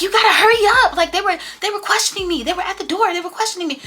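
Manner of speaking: mock frantic friend rushing. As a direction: ham up her frantic panic. Imitating frantic Coco.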